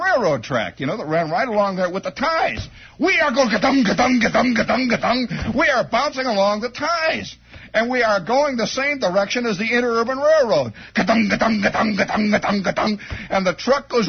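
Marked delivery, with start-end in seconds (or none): 3.0-5.5 bumping noise. Retold or imitated. Car bouncing along railroad ties, rhythmic thumping noise. 10.9-13.0 bumping noise. Retold or imitated. Car bouncing along railroad ties, rhythmic thumping noise.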